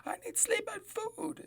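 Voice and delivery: high whining plea; whiny complaint. Pretend. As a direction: whine like a tired kid.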